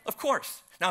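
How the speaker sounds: usual speaking voice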